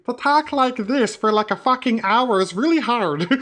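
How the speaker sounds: high, slow little goblin voice; put-on goblin voice. Shown as a demonstration. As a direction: push the voice up into a strained character pitch. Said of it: "for someone who's got a voice like mine".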